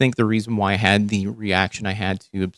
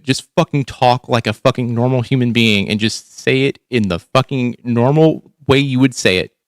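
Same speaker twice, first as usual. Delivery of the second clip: usual speaking voice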